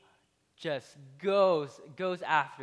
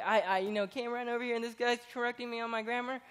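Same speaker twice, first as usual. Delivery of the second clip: indignant inner voice; 7 semitones higher, faster than usual. Retold or imitated. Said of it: "this is what is going through this guy's mind".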